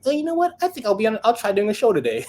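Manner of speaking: high, airy mock voice of carefree first-timers; mocking casual newbies. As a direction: lampoon the breezy rookies in a high voice. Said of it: "And you guys are like".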